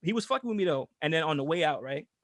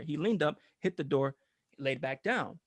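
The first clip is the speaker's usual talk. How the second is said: normal talking voice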